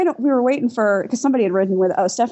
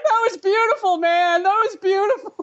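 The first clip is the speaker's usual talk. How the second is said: high, overcome-with-emotion imitation; mock tearful; 10 semitones higher than usual. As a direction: gush as if moved to tears. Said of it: "like, in tears".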